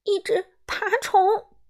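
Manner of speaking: high, slow frightened cry; scared girl crying out at a spider; trembling cry. Acted. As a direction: quaver it out, scared of the bug. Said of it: "达芙妮声音颤抖着叫道".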